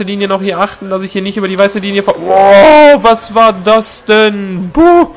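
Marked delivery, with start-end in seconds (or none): 4.7-5.1 sudden high loud yelp; startled yelp. Real, not acted. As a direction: yelp in fright. Startled by something on track.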